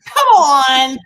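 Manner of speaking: loud, incredulous come on; disbelieving yell at the screen; exasperated shout. Real, not acted. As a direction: throw it out like you can't believe it. Exclaiming at crazy stunt.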